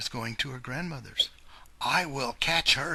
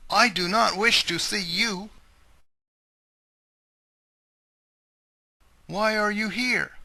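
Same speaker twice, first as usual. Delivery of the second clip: alarmed cry; 9 semitones higher, 9 dB louder than usual. Acted. Grandmother alarmed by wolf.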